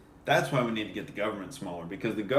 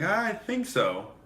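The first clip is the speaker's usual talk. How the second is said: meek mock reply; 10 semitones higher, 4 dB louder, much slower than usual. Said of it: "She's like".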